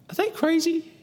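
can you believe this; incredulous. Real, not acted. Preacher asks the congregation in high disbelief.

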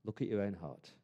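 soft, hushed rebuke from within; a quiet, kind check on the heart; gentle inner voice. Retold or imitated. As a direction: pull them up gently and quietly. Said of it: "the Spirit of God prompts us and said".

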